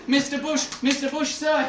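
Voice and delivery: high, loud, eager calls; exclaiming. Acted. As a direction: call out excitedly for the boss. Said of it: "he exclaimed".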